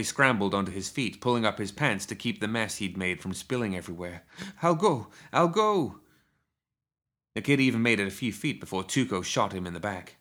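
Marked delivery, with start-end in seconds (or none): 4.6-6.0 put-on high, frightened kid's voice; scared, pleading promise; panicked agreement. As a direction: hurriedly give in, pitched way up. Kid begging to escape gunman.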